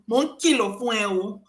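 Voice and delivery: a playful Yoruba "Mo ń kìlọ̀ fún ẹ o"; Yoruba warning. Shown as a demonstration. Translating gentle reminder for listeners, playful Yoruba. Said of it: "Let me say it in Yoruba too".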